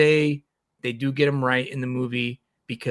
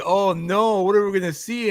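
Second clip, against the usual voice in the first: high-pitched retold alarm; mock dread; 6 semitones higher, 3 dB louder than usual. Reader retelling suspense over the comic, high mock alarm. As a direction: raise the voice in playful dismay.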